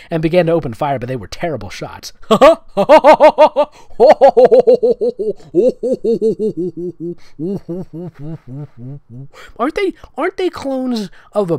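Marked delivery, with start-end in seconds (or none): 2.2-9.5 loud helpless laughter; long laugh. Real, not acted. Reader laughing at the terrible shots, long loud laugh. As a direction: crack up completely at the writing.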